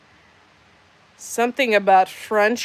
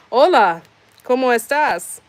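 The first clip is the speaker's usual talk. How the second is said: bright, loud and higher, laughing into hola; excited Spanish greeting; 6 semitones higher, 8 dB louder than usual. Acted. Greeting the Mexican lady viewer with a loud, excited hola. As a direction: greet her warmly in Spanish, pitched up and louder.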